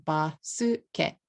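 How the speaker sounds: "basuketo" chopped into four Japanese syllables; katakana pronunciation. Shown as a demonstration. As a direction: say "basuketo" as ba-su-ke-to, vowel after each consonant. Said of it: "If you say it with katakana".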